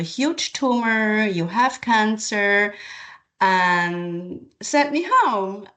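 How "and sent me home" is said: ordinary talk, nothing marked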